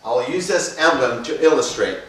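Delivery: loud, projecting class delivery; instructor voice. Acted. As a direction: address the class with energy. Teaches the emblem concept.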